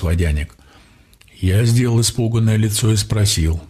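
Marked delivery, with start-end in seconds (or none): none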